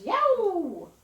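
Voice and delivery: high, loud jet roar; jet noise imitation. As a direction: let it scream overhead like a jet. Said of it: "A jet goes".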